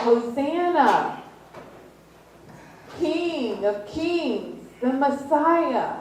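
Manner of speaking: high, slow proclaimed cry; crowd yelling. Retold or imitated. Crowd hailing Jesus on the donkey, high slow shout. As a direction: call it out like a cheering crowd. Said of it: "yelling out".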